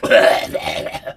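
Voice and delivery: deep, harsh fake cough. Shown as a demonstration. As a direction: cough hard to show the sickness. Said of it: "awful cough, like".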